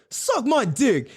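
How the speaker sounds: high, slow trash-talk jeer; imitated taunt. Repeats the rival rapper's taunt.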